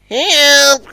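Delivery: high, loud cry for help; Izzy crying out. A narrator's reading. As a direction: shriek in panic. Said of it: "cried Izzy".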